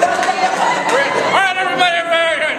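high-pitched call over a noisy crowd; calling for attention. Real, not acted. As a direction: rein in the room, pitched well up.

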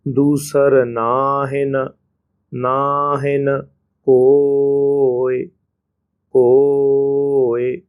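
ਨਾਹਿਨ ਕੋਇ stretched very slowly, syllable by syllable; ਕੋਇ held long and slow; slow drawn recitation. Shown as a demonstration. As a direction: draw out ਕੋਇ for the students. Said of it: "slow syllable demo".

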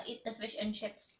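ordinary talk

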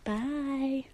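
a voiced quiet goodbye, still eerie. Saying goodbye again to fans, gently. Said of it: "That was creepier".

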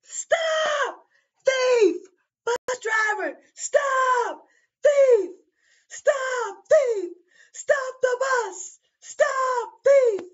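put-on very high, loud shrieking old woman; old lady yelling. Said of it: "she yells out".

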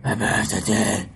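low, slow Batman growl; Dark Knight Batman. Acted. [name] doing Batman for his viewers, low slow growl. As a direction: grind out the line like the Dark Knight. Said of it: "My first impression is going to be Batman".

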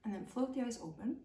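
soothing teacher guiding awareness; guided meditation. Acted. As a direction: guide them gently into noticing the body. Guided pause in the body.

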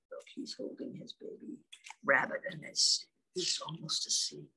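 hushed, sleepy whisper; soft whisper. Real, not acted. Parent pointing at picture for baby, whispering.

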